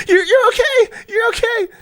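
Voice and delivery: very high, crying, breathless screech; mock hyperventilating cop. Cop over the man she shot, shrill mock sobbing. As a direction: squeal it out between panicked breaths. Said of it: "she's hyperventilating as she's doing it".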